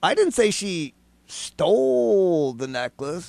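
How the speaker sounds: STOLE drawn way out after a pause; pause, then stretch "stole" long; stress on STOLE. Shown as a demonstration. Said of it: "all the different meanings it could have".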